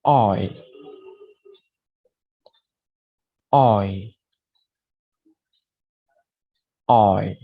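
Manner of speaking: an isolated "Oi" for students to repeat; teacher modelling "Oi"; model the Oi sound. Shown as a demonstration. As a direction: say "Oi" as a clear o-to-i glide. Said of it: "Please repeat after me".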